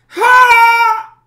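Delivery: short, very high full shout; sudden piercing yell cut off as the victim burns; momentary death cry. Acted. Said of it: "all you hear is a momentary".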